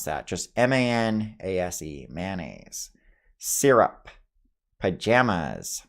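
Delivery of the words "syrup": ordinary talk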